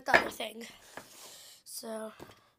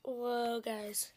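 normal talking voice

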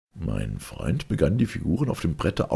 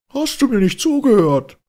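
high, loud exasperated cry; much higher, louder indignant friend; exasperated outburst; 9 dB louder than usual. Acted. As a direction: snap the question at him in disbelief. Friend cuts narrator off.

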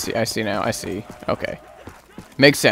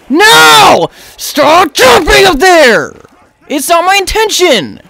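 high, loud frustrated shout; real frustration mid-game; frustrated yell; 9 semitones higher, 3 dB louder, slower than usual. Real, not acted. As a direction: yell it at the controls. Character jumps wrongly.

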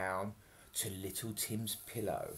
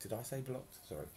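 plain reading voice

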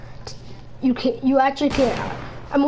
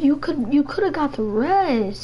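usual speaking voice

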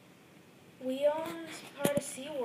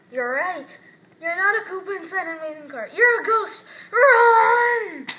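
screaming in terror, higher and much louder; panicked scream; 3 semitones higher, 17 dB louder than usual. Acted. Scared toy screams at the ghost and flees. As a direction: shriek and flee in pure fright.

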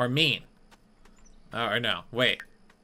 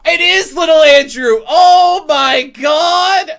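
very high, loud, drawn-out cry of realization; stretched, shouted disbelief; shocked wail; 12 semitones higher, 11 dB louder, much slower than usual. Pretend. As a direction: wail it in overblown horror. Recognises the kid, mock horror.

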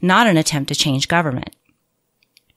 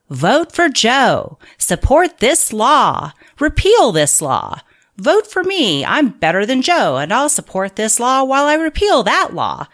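mock campaign slogans; 6 semitones higher, 4 dB louder than usual. Author mocking political campaigners in a loud rally voice.

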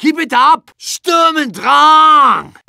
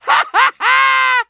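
maniacal laugh; 11 semitones higher than usual. Acted. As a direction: cackle like a mad scientist. Bird head madness.

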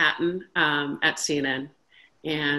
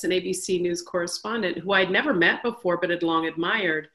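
ordinary talk

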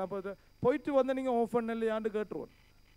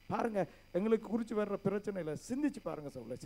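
usual speaking voice

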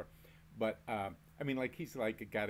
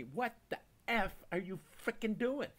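high, pitched-up exasperated yell at wife; retold angry yell; 10 semitones higher than usual. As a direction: shout it high in mock outrage. Hit in head, yelling at wife.

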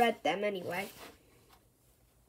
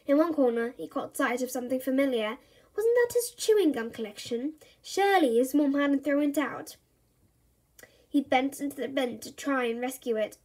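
usual speaking voice

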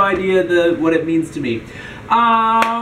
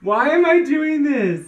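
high-pitched laughing lament; laughing dismay; 7 semitones higher than usual. Real, not acted. Host about to drink the disgusting smoothie, lamenting. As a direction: wail it through a laugh.

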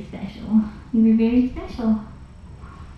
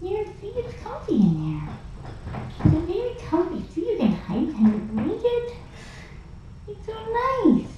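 higher, soothing coax; 9 semitones higher, 3 dB quieter than usual. Real, not acted. Carer coaxing the cat into the carrier, high and soothing. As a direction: coax the cat gently, pitch up.

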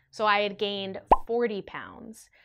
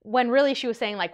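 normal talking voice

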